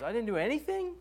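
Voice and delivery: the king can't believe he did nothing; surprised king. Retold or imitated. King voiced in retelling.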